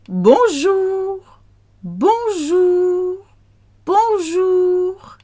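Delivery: very high, held "Bonjour"; high drawn-out French greeting; sing-song bonjour. Shown as a demonstration.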